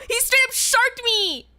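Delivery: high, drawn-out protest; aggrieved wail; indignant outcry. Acted. Indignant at being tricked.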